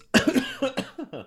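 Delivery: sudden choking cough; coughing fit. Real, not acted. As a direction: cough hard after swallowing wrong. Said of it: "Ich hab mich verschluckt an mir selbst".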